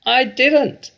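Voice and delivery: put-on high, loud, cackling old woman; a gleeful grandma cackling down at her daughter. A narrator's reading. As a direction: cackle it with glee. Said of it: "cackled the old woman".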